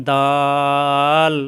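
দাল with a very long held vowel, tongue returning to the teeth on ল; articulation demo. Shown as a demonstration. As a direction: stretch দাল out slowly on one level note. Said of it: "ছেড়ে দিয়ে আবার ওখানেই লাগাতে". (দাল টেনে, জিহ্বা আবার লাগিয়ে উচ্চারণ)

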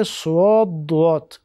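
slow سواد، دوات as "su-ood, du-ot"; added waw sound. Shown as a demonstration. A student being taught wrong letter names, inserted w glide.

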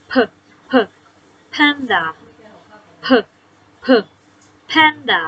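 เผิก เผิก as puffs of the p sound before panda; teacher sounding out the p sound; phonics sound. Shown as a demonstration. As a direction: breathe out the p sound, then say panda. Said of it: "เสียงพอพานนะ".

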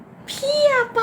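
begging him not to leave; pleading. Acted. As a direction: stretch out a girlish plea. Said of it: "พูดสิ".

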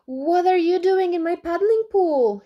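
high, loud astonished question; indignant high-pitched disbelief; stunned. Acted. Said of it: "Alby was stunned".

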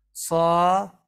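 صا said loud with a different mouth shape; a second variant of صا, loud; second demo take of صا; sad sound, take two. Shown as a demonstration. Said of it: "صاد رو الان تلفظ کنم".

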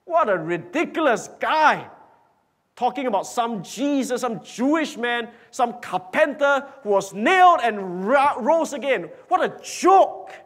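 loud, high-pitched scoffing; acted ridicule from a scornful crowd; mocking. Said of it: "some mocked".

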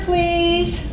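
ordinary talk, nothing marked

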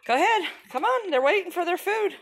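high-pitched encouraging call; high coaxing. Real, not acted. Coaxing child to hurry.

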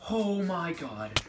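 loud, slow, drawn-out gasp of relief; shaken. Real, not acted. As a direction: let the fright drain out slowly and loudly. Still reeling from the scare.

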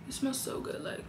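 breathy whisper, almost no voice. Real, not acted. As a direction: whisper it like a secret. Praising the perfume again.

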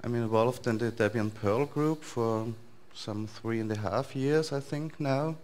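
ordinary talk

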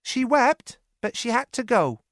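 put-on a young maid breaking news; Lizzie's voice. A narrator's reading. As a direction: servant girl telling sad news. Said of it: "Lizzie said".

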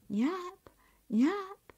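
very high, rising singled-out name; high lilting call from a magic plate. Acted. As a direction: lure her over by name. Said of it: "the plate called out".

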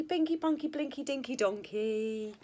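ordinary delivery, nothing marked

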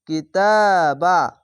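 কিতাবা slow with the তা held long; teacher modelling the long a; stretched vowel. Shown as a demonstration. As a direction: pull the vowel in কিতাবা one alif. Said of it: "খাড় জাবার এক আলিফ টেনে পড়বেন".

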